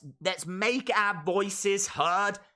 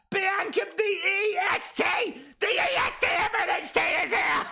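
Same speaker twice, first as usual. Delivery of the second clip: ecstatic cheering; 9 semitones higher than usual. Real, not acted. Streamer cheering Bianca Belair's arrival, screaming with joy. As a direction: scream her name like a fan at ringside.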